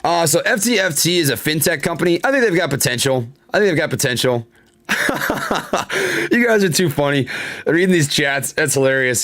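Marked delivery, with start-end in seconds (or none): 4.9-6.3 high burst of laughter at the chat; amused laugh. Real, not acted.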